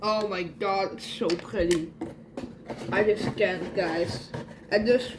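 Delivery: ordinary talk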